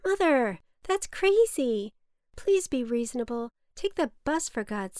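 can't believe the price she's paying; rising exasperation at her mother; exasperated outburst. Acted. Daughter hears the taxi price.

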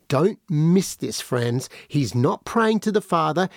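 ordinary delivery

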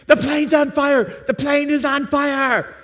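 high, loud, drawn-out screaming of the alarm; retold panicked scream. A woman on the plane screaming to everyone, high and loud. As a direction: shriek it like a terrified passenger. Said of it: "a lady across the aisle screams".

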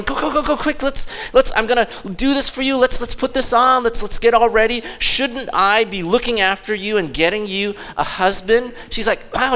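high, quick, eager chatter; bubbling over to get her moving; so excited. Retold or imitated. Naomi urging Ruth to get ready for Boaz, excited. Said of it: "She's, like, so excited".

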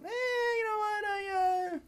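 very high, silly put-on voice; mock customer. Customer dodging the bill with server, mock whine. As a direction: squeak out a flimsy excuse.

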